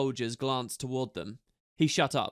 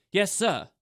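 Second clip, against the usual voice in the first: higher, crisp soldier's reply; to attention; 6 semitones higher, 4 dB louder than usual. A narrator's reading. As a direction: snap to attention. Ember petrified before commander.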